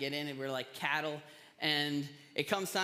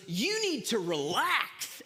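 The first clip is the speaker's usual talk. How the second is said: firm, slow and louder, mom shutting it down; imitating a tense mother's rebuke; a mother snapping at her daughter to relax; mock stern snap; 5 semitones higher, 6 dB louder, slower than usual.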